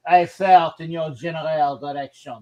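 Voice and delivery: hammed-up French accent, Monty Python style; a lightly put-on French taunt; lazy mock French accent quoting Monty Python. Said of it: "French accent".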